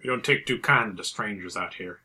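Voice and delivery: lightly put-on Arizona accent; a hint of Arizona accent, playing the local. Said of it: "In my Arizona accent".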